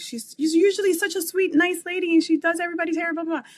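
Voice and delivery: high, loud, rushed disbelief; shocked mom. Retold or imitated. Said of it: "like, shocked".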